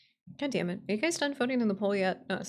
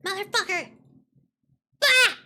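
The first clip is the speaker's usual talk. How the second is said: high-pitched curse into a yell; mad outburst; 10 semitones higher, 6 dB louder than usual. Real, not acted. Streamer failing to fix the window, mad outburst. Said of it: "I'm so mad".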